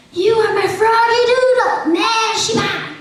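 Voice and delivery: high, theatrical christening cry, far from a whisper; naming ceremony voice. Acted. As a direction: proclaim the new name in a high put-on voice. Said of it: "would whisper the coveted name".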